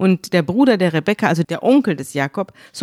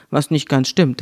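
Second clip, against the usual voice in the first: ordinary talk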